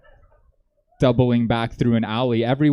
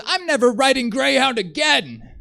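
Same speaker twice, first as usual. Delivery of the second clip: upset passenger; 11 semitones higher, 4 dB louder than usual. Retold or imitated. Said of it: "he was so upset".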